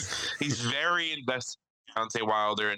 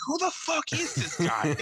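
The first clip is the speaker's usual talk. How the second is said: baffled. Retold or imitated. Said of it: "I'm like".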